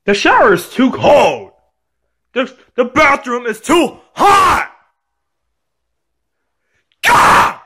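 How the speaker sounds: high, wailing complaint; tantrum yelling. Acted. Child screams complaints about bath.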